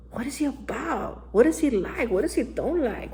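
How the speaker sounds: ordinary delivery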